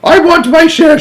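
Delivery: very high, loud furious shout. A narrator's reading. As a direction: roar it greedily. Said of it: "shouted Grandpa George".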